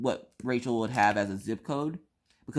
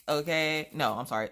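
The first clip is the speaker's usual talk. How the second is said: ordinary talk, nothing marked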